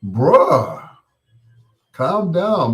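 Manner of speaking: loud, drawn-out mock alarm; taken-aback appeal. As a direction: call out to settle a worked-up friend. Retold reaction to someone going off.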